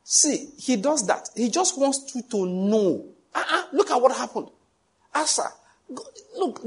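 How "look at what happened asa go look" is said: ordinary talk, nothing marked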